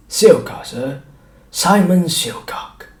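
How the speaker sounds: grovel to please the gentleman; eager showman serving the doctor; fawning shopkeeper. A narrator's reading. Shopkeeper introduces himself.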